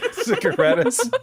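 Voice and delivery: laughing, high and loud; incredulous laugh; laughing disbelief. Real, not acted.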